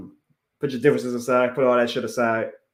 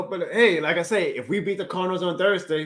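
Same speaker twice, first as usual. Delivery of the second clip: ordinary talk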